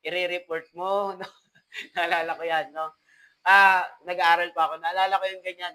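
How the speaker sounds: normal talking voice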